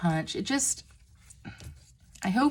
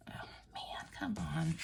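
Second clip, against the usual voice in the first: whisper; 4 semitones lower, 13 dB quieter than usual. Real, not acted. Fumbling with ribbon, cold hands.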